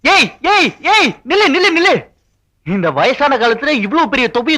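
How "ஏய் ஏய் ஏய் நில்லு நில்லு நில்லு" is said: calling out. Acted. Calling after a girl running off.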